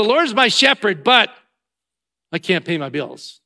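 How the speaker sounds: loud faith claim, then a sagging 'but' complaint; complaining self-talk. Shown as a demonstration. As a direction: concede it up high, then let the trouble drag you down. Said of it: "we talk like this".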